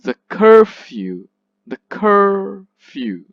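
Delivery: loud, long CUR in "curfew", then a drop on "few"; stressed CUR, weak few. Shown as a demonstration. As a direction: stretch and punch the first syllable of "curfew". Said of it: "that is stressed, unstressed".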